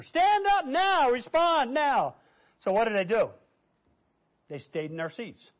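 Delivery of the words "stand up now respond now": slow, high, forceful command; preacher's challenge. Retold or imitated. Preacher challenging shocked listeners to respond, slow forceful command. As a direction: stretch each word into a ringing demand. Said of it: "that kind of a challenge from a preacher".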